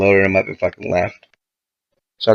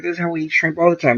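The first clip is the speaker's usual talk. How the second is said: higher, mock girly voice, quick; mocking the girls; 9 semitones higher, faster than usual. Mocking the girls who ate aguachile wrong, higher and fast. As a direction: mimic them, airy and dismissive.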